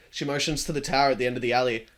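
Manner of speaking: usual speaking voice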